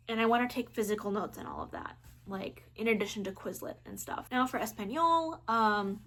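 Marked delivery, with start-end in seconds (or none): 4.6-5.4 clumsy attempt at a Spanish accent; American-tinged Spanish accent; terrible Spanish accent. Real, not acted. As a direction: say it in poorly pronounced Spanish. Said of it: "that was a terrible accent".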